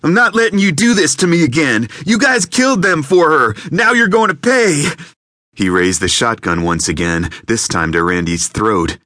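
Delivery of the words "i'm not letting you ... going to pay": very high, loud yelling; furious shout. Acted. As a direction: explode at the intruder. Gunman threatens, raising shotgun.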